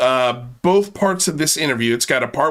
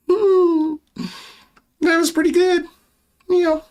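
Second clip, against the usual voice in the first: much higher, smug self-praise; self-satisfied; 13 semitones higher than usual. Pretend. The host praising his own joke in a smug, much higher voice. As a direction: pat yourself on the back.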